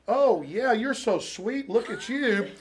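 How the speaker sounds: sweet lilting coo, hammed up; acted cooing. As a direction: imitate someone doting on a cute dog. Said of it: "they literally see crew members".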